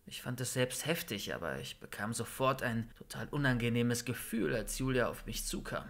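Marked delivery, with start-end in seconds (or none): none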